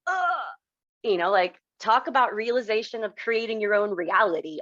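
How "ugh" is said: a high, choked sob of emotion; real tearful cry recalling dad. Real, not acted. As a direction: let a sob break out. Overcome recalling father's words.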